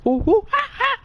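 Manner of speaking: high nervous ooh-ah squeals. Real, not acted. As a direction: blurt high yelps from nerves. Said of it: "I do that when I'm nervous".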